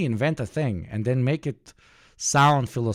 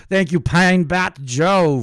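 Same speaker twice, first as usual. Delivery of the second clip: excited shout-out; 6 semitones higher, 7 dB louder, slower than usual. Real, not acted. Streamer thanking a subscriber loudly.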